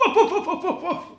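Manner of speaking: high, loud yappy woofs; dog barking. Acted. Toto the dog barking at the lion, high and loud. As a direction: bark like a small dog. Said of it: "Toto barked".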